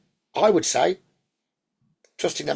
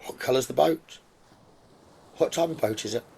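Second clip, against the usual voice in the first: guided meditation; 2 semitones lower, 4 dB quieter than usual. Acted. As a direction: lull them gently toward the island.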